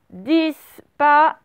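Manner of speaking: "disparition" slow and loud, split dis-pa-ri-tion; syllable by syllable. Shown as a demonstration. Teacher warns pupils of missing letters, "disparition" syllable by syllable. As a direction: dictate "disparition" in four detached syllables, the S sounded. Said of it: "faites bien syllabe par syllabe".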